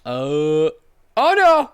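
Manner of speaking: sudden high, loud alarm; startled cry at a misclick; real surprise. Real, not acted. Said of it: "I didn't mean to click that".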